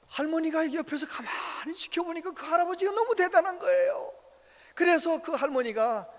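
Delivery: normal talking voice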